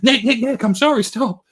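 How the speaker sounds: high, loud mock panic; acted-out frantic apology; panicked pleading. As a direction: plead urgently to call off an attacker. [name] pleading as Nico charges.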